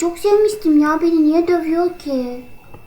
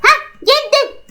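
ordinary talk, nothing marked

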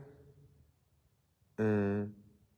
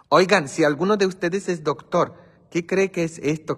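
raised appeal; 7 semitones higher, 5 dB louder than usual. Real, not acted. Asking viewers for medical help.

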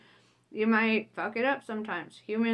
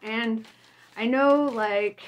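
ordinary delivery

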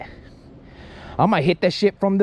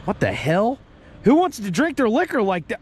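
usual speaking voice

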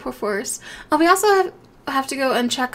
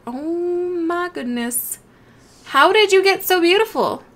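cooing; 5 semitones higher, 4 dB louder, slower than usual. Real, not acted. Cooing at the ocelot.

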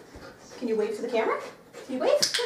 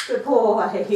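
loud, drawn-out praise with a fall; hearty praise; 8 dB louder than usual. Real, not acted. Trainer rewarding her dog for a shake, hearty praise.

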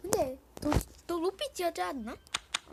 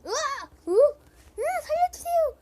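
high panicked scream for help; screaming in fear; 12 semitones higher, 8 dB louder, slower than usual. Real, not acted. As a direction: shriek like you're falling. Falling in game, cries for help.

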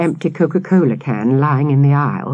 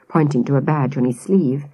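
plain reading voice

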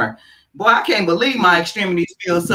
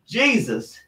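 usual speaking voice

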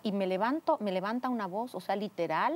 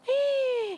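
loud, high sharp gasp; shocked gasp; 5 dB louder than usual. Retold or imitated. Woman retelling seeing her test result, high shocked gasp.

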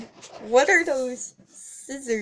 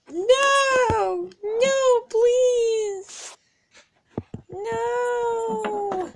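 high, loud crying no; crying plea; 11 semitones higher, 3 dB louder, much slower than usual. Acted. Pony begging not to lose her hair, high and crying.

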